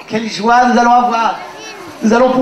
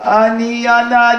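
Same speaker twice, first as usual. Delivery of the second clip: ordinary talk, nothing marked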